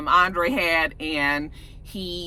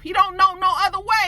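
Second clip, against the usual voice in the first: normal talking voice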